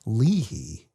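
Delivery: Leahy said as LEE-hee, two clear syllables; Cherokee-style name. A narrator's reading. Old man pronouncing the narrator's name Leahy as lee-hee. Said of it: "He pronounced my name".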